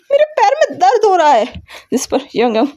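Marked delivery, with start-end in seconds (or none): 0.1-1.5 shouting in pain. A narrator's reading. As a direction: yell it out, hurting. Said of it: "चिल्लाते हुए कह रही थी".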